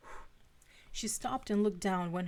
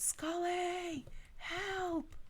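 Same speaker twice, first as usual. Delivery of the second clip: much higher, desperate distant cry; crying for help; 11 semitones higher, 3 dB quieter, much slower than usual. A narrator's reading. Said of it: "Mulder's voice cried out".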